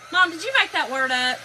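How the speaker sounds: high, loud mock challenge; kids quizzing mom. Boys questioning their mom over a word, loud mock challenge. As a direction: loudly imitate an incredulous kid. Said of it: "they were like".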